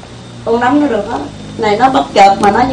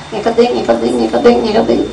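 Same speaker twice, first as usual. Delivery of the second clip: mock hearing it; 2 semitones lower, 6 dB quieter than usual. Shown as a demonstration. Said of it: "Khi mà nó nói cái mình làm tin".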